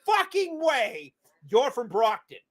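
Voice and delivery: stretched-out mock disbelief; incredulous disbelief. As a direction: drag it out in disbelief. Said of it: "And I'm just like".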